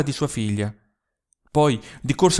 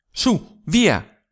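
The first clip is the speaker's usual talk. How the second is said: urgent call; 7 semitones higher, 6 dB louder than usual. Acted. Jeli urging departure.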